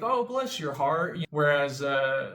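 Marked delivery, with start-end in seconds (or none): 0.0-1.2 slow, higher Southern drawl, overly polite; Southern stranger driving past a flat tire, mock pity; mock Southern sweetness. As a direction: put on a Southern lady passing by with fake sympathy. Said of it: "Southern people will be nice".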